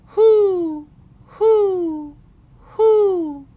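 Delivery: high, loud hooting reply; owl call back. A narrator's reading. Pa calling back to the owl like a conversation, high loud hoots. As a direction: hoot back to the owl as if talking with it. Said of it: "Then he called back".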